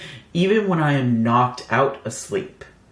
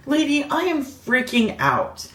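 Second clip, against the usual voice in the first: exasperated inner rant; 7 semitones higher than usual. Retold or imitated. Thinking while the nurse stares.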